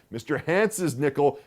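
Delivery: usual speaking voice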